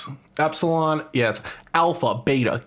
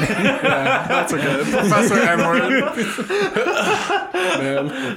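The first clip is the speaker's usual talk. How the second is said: high, laughing through words; 10 semitones higher, much slower than usual. Real, not acted. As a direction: talk while cracking up. Cracking up at joke.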